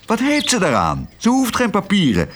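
high-pitched, cross exclamation. A narrator's reading. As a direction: snappish complaint, pitched well up. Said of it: "riep Kwark nijdig". (nijdig uitgeroepen)

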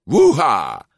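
loud, high whoop; triumphant cry. A narrator's reading. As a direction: whoop with fists in the air. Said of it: "in de lucht en riep". (juichende kreet)

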